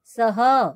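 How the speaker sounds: सह with an audible breathy "ha" at the end; visarga pronunciation. Shown as a demonstration. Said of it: "से नहीं बोलेंगे इसको".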